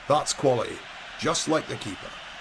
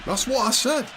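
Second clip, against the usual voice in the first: defensive; 11 semitones higher than usual. Acted. Defensive reply to correction.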